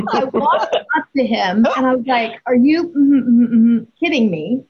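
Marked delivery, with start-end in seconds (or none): 2.5-4.6 self-censored swearing. Retold or imitated. Mother confronting her runaway son at the drive, retold self-censored cursing. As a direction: hum hard over each swear word. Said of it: "I used explicit conversation".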